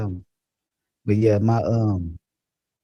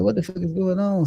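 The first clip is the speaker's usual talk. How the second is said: put-on higher, confused baby-bird panic; retold panic; 9 semitones higher than usual. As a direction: blurt it out, lost and scared. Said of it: "I'm like".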